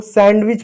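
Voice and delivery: सैंडविच with a sounded d in the middle; D pronounced. Shown as a demonstration. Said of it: "सैंडविच नहीं होता है". (डी बोलकर "सैंडविच")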